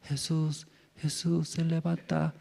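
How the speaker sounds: soft, murmured call that wakes no one; too soft a wake-up call. Shown as a demonstration. Preacher demos a weak call to wake Jesus, soft. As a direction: call him gently, far too quietly. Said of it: "¿Así ya usted levanta?".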